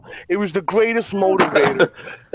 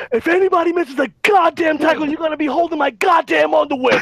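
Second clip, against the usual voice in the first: crazy coach yell; 10 semitones higher than usual. Retold or imitated. Coach threatens the kids at practice, yelling high. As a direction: bark the threat at the team. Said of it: "I got crazy".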